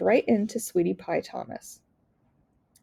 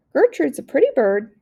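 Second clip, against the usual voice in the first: higher, louder squawking parrot voice; parrot scream; 7 semitones higher, 7 dB louder than usual. Acted. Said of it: "screamed Gertrude".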